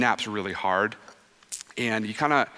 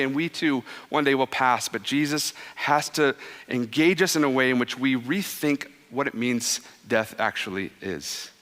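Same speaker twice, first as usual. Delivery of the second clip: ordinary talk, nothing marked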